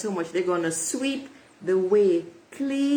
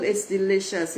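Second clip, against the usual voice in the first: normal talking voice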